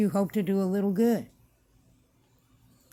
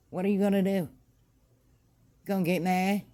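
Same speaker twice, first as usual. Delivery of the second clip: normal talking voice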